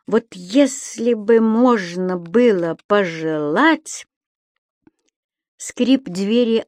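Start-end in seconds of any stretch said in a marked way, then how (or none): none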